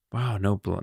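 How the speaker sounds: ordinary talk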